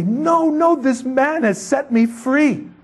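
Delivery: high-pitched, urgent acted outcry, stretched at the end; desperate proclaiming. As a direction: cry it out to a whole crowd. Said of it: "ran around and say".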